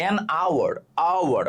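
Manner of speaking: "hour" said with no h, starting on an "aa" vowel; teacher models "hour" opening on the vowel; silent H. Shown as a demonstration. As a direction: say "hour" as "aa-war". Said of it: "উচ্চারণটা আ হয়েছে".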